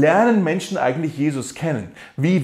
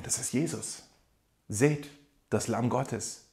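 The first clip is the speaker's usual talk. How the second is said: hushed quote; 3 semitones lower, 10 dB quieter than usual. Retold or imitated. Retells John pointing out Jesus.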